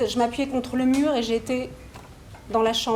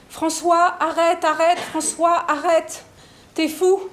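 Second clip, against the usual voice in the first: higher, louder pleading cry to stop; retold child's shout; 5 semitones higher, 4 dB louder, slower than usual. A son shouting at François to stop, loud retold cry. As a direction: call out to make him stop, as the boy did. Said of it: "c'était Pablo qui criait".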